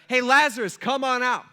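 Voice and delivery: high, loud call into the tomb; loud shout. Retold or imitated. Jesus calling Lazarus out of the tomb: loud shout. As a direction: call out with full voice. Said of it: "he just shouts with a loud voice".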